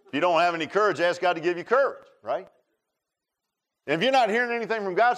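high, loud preacher's push; full-force preaching. Acted. Pastor urging the congregation, preaching at full force. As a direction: drive the point home to the pews.

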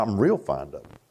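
low, subdued acted reply; humbled, hesitant Peter. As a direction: answer modestly, afraid to boast. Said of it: "he was afraid to boast".

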